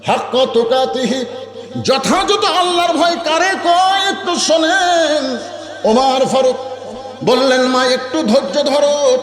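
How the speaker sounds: high, ringing sermon chant; impassioned preaching. Acted. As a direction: preach it high, stretching the phrase endings. Preacher at full pitch.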